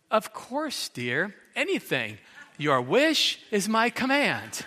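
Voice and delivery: hammed-up sugary wife with a laugh; mock sweet. Preacher joking about his wife's answer, mock sweet. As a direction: play the ever-obliging wife, tongue in cheek. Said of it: "she usually says".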